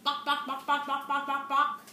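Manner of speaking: mocking hen clucks; naughty hens clucking at the piglet. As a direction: cluck like gossipy hens laughing. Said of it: "gossipy hens laughed".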